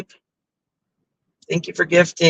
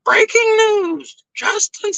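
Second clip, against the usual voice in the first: high, slow newsreader voice; mock breaking news announcement; announcer voice; slower than usual. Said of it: "she's like".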